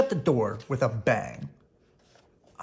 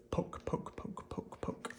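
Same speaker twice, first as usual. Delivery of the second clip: whispered poke; 14 dB quieter, slower than usual. Acted. Prodding sound words.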